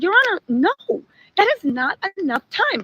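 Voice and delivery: hammed-up retelling of an objection; unprofessional mock objection. Prosecutor jumps up to object to the judge, exaggerated protest. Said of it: "she says it more professionally".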